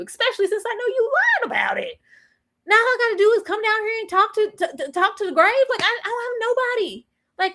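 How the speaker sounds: high, lightly whiny lament; a hint of a high, hammed-up cry; mock grieving Raquel. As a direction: wail a little over her brother. Raquel grieving her lost brother.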